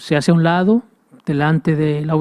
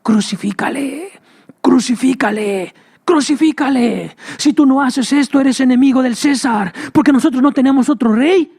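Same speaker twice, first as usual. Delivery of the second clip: crowd shouting; 7 semitones higher than usual. Acted. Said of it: "lo único que oía decir era".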